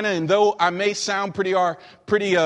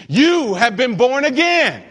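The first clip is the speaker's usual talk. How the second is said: high, slow, ringing preacher's cry; forceful proclamation; 4 semitones higher, 4 dB louder than usual. Acted. Preacher answering identity doubts with Peter's words, forceful proclamation.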